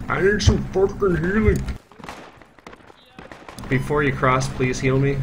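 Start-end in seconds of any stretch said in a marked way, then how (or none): 0.1-1.6 exasperated, pitched way up; frustrated high yell. Real, not acted. Asking again, more urgent.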